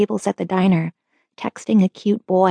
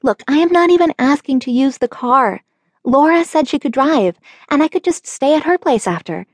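pleading; 7 semitones higher than usual. A narrator's reading. Teen pleading with dad.

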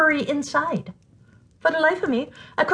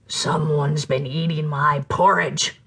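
much lower, slow bear growl; growling Papa Bear; 7 semitones lower, slower than usual. Acted. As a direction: rumble it like a big father bear. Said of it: "growled Papa Bear".

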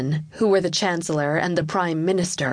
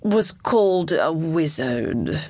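slow, halting official's voice; reluctant courtier; 3 semitones lower, slower than usual. Acted. Chancellor confiding about the late king, slow and grave. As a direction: let it out slowly and reluctantly.

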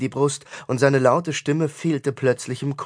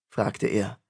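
ordinary delivery

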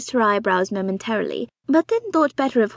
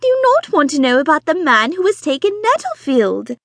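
high, loud, breathless society wife; fussy excited mother; 5 semitones higher, 6 dB louder than usual. Acted. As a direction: gush it out like an eager matchmaking mother. Mrs Bonnet insisting.

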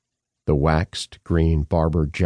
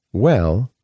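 a weighty, higher "Well" to open the point; with emphasis; 5 semitones higher, 4 dB louder than usual. A narrator's reading. Professor answering Hastings about money, emphatic "Well". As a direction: lean hard on "Well" before the rebuttal. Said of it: "Appleby said with emphasis".

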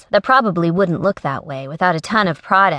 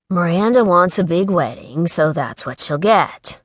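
ordinary delivery

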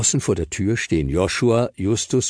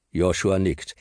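usual speaking voice

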